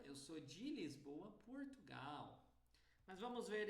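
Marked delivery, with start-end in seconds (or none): 0.0-2.4 Brazilian Portuguese accent with open vowels. Shown as a demonstration. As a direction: say it as a Brazilian would. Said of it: "Já um brasileiro".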